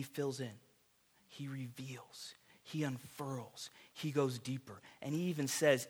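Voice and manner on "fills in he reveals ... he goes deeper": softer, slow, near whisper; hushed. Real, not acted. Preacher to congregation, slow hushed reverence. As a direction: draw them in with a hush.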